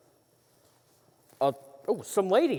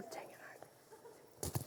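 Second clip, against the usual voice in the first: under-breath whisper; frustrated whispered aside; whispered curse; 27 dB quieter than usual. Acted. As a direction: curse to yourself, voiceless.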